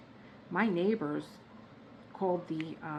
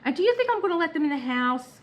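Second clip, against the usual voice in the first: exasperated; 11 semitones higher, 8 dB louder, faster than usual. Retold or imitated. Exasperated at friend Sean.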